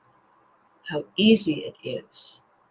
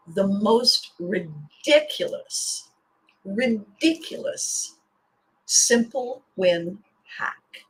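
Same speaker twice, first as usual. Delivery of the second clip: slow, stretched words for build-up; drawn-out emphasis; much slower than usual. Real, not acted. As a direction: drag out "ridiculous" like a big reveal. Building up her big tip.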